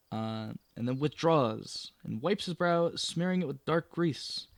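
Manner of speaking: projected storytelling voice; story narration. Acted. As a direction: read it out like a game master setting the scene. Reads the adventure text aloud.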